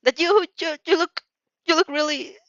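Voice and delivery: flustered stammer. Acted. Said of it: "he shouts with a horrified whimper".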